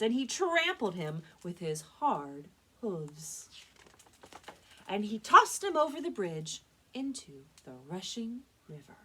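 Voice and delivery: emphatic reading with punched action words; storytime narration. A narrator's reading. Librarian tells how the big goat won, emphatic storytelling. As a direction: tell it with gusto and deliberate pauses. Reads picture book to children.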